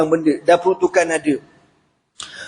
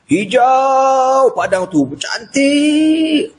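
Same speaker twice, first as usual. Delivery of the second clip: higher, very slow marvelling; awed wonder; 9 semitones higher, much slower than usual. Acted. Preacher describes the green field of the dream in wonder. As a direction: savour the beauty in awe.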